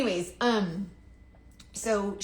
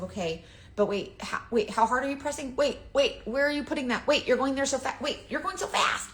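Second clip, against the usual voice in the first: flustered; 6 semitones higher, 3 dB louder than usual. Retold or imitated. Mock frantic viewer of tutorials.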